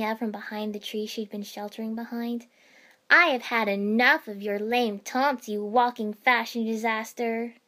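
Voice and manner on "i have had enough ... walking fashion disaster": loud ranting tirade; shrill rant. A narrator's reading. Ino ranting at Temari as a distraction, loud. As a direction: rant at her at full volume. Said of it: "she hit a particularly shrill note".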